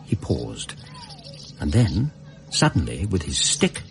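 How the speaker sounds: measured, reflective storytelling voice; story narration. A narrator's reading. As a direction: recount it like a memoir read aloud.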